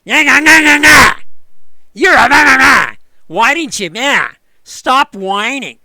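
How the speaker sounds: high, loud, sneering nah-nah taunt; mocking taunt. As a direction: jeer at him like a smug forum troll. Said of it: "people who love to come on and say".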